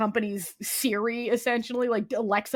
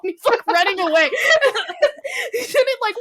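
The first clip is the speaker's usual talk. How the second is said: laughing through the retelling, higher; giggling as she describes it; 11 semitones higher, 4 dB louder, faster than usual. Real, not acted. Telling her cohost about the cameo, laughing. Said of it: "laughing while retelling".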